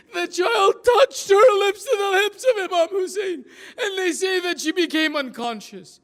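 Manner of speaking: high, loud wailing lament chant; mournful masa'ib recitation. Acted. Preacher chanting the tragedy to mourners, wailing lament.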